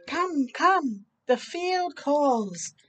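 slow, sung-out reading voice; story narration. A narrator's reading. Reading aloud to children, slow storybook voice. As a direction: read it like a picture book.

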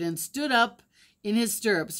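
ordinary delivery

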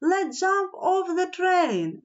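eager urging that climbs high; mildly frantic storybook urging; urgent exclamation. A narrator's reading. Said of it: "Nelly exclaimed".

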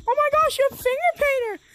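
mock-excited high voice of another player; imitating excited kid. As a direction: mimic the gushing kid. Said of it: "The kid is like".

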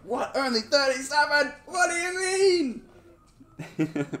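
hammed-up high shriek, last words stretched; mock disbelief. Streamer imitating a commenter shocked at thirty-seven, mock outraged squeal. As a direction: imitate a shocked viewer, pitch shooting up. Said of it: "You're just like".

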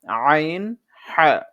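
আইন with the throat squeezed tight; throat-pressed ain. Shown as a demonstration. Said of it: "গলাকে চিপিয়ে". (গলা চিপিয়ে আইন)

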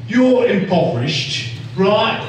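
demonstrated Cockney, lilting upward; playful Cockney patter; Cockney accent. Shown as a demonstration. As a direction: tell the tale as a Cockney storyteller. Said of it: "Can you do a Cockney?".

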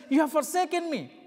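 high, loud, wounded cry; the son crying up to his Father in anguish. Acted. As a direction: cry it out in betrayal. Jesus accusing the Father.

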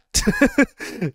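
sudden high-pitched laugh; real laugh at the confusing ending; high burst of laughter. Real, not acted.

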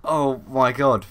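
ordinary talk, nothing marked

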